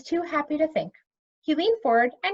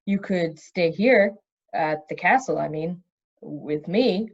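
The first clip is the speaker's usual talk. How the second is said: slow, halting nervous offer; nervous hesitant; 7 semitones lower, slower than usual. Acted. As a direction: pause and stumble over the words. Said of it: "took a deep breath".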